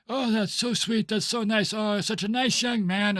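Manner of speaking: put-on high old lady voice; cooing sweet old lady. GM voices little old ladies.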